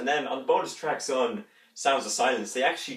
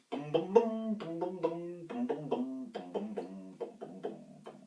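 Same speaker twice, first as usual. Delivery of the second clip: soft booming bass riff, high then descending; imitated bass line; 3 semitones higher, 9 dB quieter, slower than usual. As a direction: hum out a bass line, fading away. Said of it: "Shrek's bass going".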